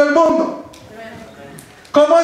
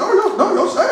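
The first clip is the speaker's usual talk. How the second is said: lightly voiced believer, slightly raised pitch; quick, higher mock reply of a Witness; imitating Jehovah's Witnesses; 5 semitones higher than usual. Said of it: "Los testigos de Jehová dicen".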